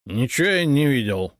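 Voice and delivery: lying to the officer with a challenge; defiant. A narrator's reading. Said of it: "с вызовом ответил пьянчуга". (с вызовом, дерзко)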